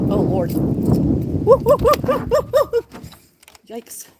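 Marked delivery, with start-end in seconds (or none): 0.5-3.1 high laugh. Real, not acted. Laughing at the cold.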